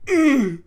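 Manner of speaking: sudden high loud yelp; ecstatic yelp. Retold or imitated. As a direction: yelp out in wild excitement. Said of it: "you going".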